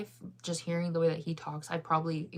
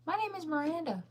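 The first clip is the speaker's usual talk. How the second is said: name repeated in a high, squeaky put-on voice; high-pitched mock insistence; 10 semitones higher, faster than usual. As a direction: say it way up high, playfully.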